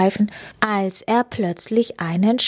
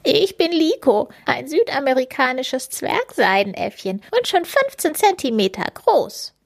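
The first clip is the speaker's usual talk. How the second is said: squeaky monkey; 6 semitones higher than usual. A narrator's reading. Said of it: "schreit der plüschige Minidieb".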